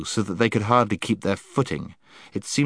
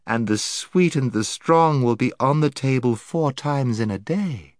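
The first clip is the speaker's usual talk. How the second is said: honeyed voice; 3 semitones higher than usual. Acted. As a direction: lure them in with sweetness. Lady's long enticing speech.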